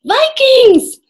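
loud, high-pitched alarm; crying out. A narrator's reading. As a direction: shout a warning of invaders. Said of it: "Jack cried".

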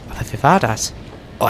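ordinary talk

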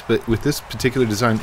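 ordinary delivery

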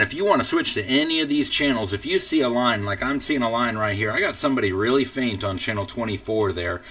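ordinary delivery

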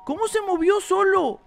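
high-pitched, startled questioning; alarmed surprise. Real, not acted. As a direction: react in alarm to a spooky discovery. Toy found moved, alarmed reaction.

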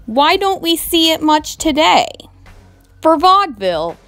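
usual speaking voice

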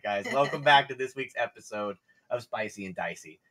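usual speaking voice

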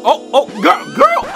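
calls out in shock at the fall; startled call out. Real, not acted. Reacting to a woman stumbling in heels, high startled squeal.

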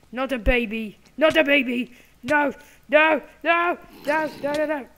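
high, loud, repeated cries of alarm; frantic, high-pitched yelling; panicked shouting. Real, not acted. Panicking at baby mob in game.

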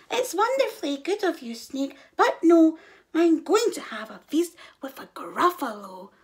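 high, polite little mouse; a brave small mouse. A narrator's reading. Mouse answers snake.

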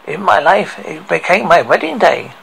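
ordinary delivery, nothing marked

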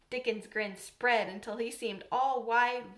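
usual speaking voice

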